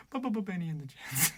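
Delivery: soft sputtering snore, lips buzzing; quiet mock snoring. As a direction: mumble it like a sleeper snoring. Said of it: "people snoring like that".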